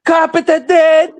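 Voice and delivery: put-on Jamaican accent. Imitating Jamaican man.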